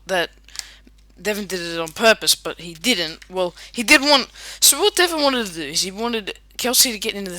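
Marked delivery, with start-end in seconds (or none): none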